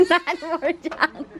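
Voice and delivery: laughing echo of a remark. Real, not acted. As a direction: repeat it through laughter, drawn out. Echoes partner's remark while laughing.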